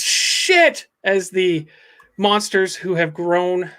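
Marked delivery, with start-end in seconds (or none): 0.0-0.9 high-pitched yelled curse. A narrator's reading. Tommy as the monsters gather around, yell. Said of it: "Tommy yells".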